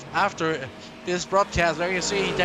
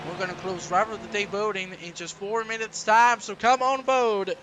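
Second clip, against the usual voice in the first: punchy race commentary, louder; live play-by-play; 4 semitones higher, 4 dB louder than usual. Acted. Commentator hyping the race to viewers, punchy and loud.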